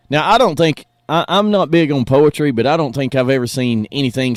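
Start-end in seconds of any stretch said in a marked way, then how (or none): none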